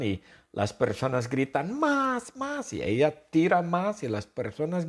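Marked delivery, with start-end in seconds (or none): 1.8-2.7 high pleading chant, fading; eager crowd. Pretend. Teacher voicing the crowd asking for more.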